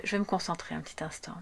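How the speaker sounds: quick, near-whispered murmur; hushed aside. Real, not acted. Card reader pausing to concentrate, hushed aside. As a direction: trail into a soft, hurried whisper.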